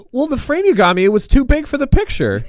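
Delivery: put-on high-pitched older mother, protesting; mock mom making excuses. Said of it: "it's just my mom voice".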